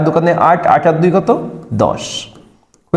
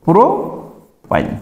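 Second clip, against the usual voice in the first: Propyne stretched very slowly, trailing softer; slowly drawn out; 3 dB quieter, much slower than usual. Shown as a demonstration. Teacher drilling the class on the name, slow and stretched. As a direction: give propyne slowly so they can repeat. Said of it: "তার মানে হলো কি?".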